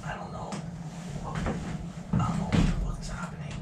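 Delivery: frightened, whispered, unsure; scared whisper. Real, not acted. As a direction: hush it out, rattled.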